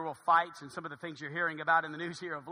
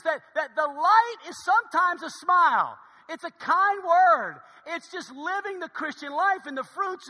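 high, loud emphatic sermon; preaching; 7 semitones higher, 7 dB louder than usual. Real, not acted. Preacher urging congregation to be a light, loud preaching. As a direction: drive the point home in a raised preacher's voice.

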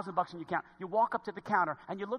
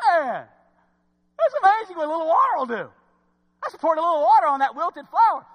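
higher, louder, quick delighted exclaiming; excited gardener; 9 semitones higher, 11 dB louder than usual. Retold or imitated. Gardener amazed at the watered flower, excited exclaiming. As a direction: marvel at the revived flower.

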